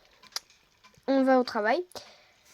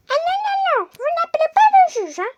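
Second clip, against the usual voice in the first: much higher, louder, just-woken prisoner; 6 dB louder, faster than usual. Acted. Prisoner complains she has been woken. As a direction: whine like a groggy prisoner.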